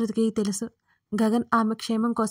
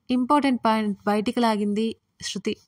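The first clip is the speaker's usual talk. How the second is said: ordinary delivery, nothing marked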